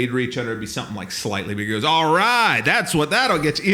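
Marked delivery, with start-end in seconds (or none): 1.8-3.7 barker boss. Retold or imitated.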